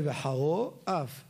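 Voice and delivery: slow "וחרו" ending in o, then "אף" with a. Shown as a demonstration. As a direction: say kamatz as o in "וחרו", patach as a in "אף". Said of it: "לפי אשכנזים".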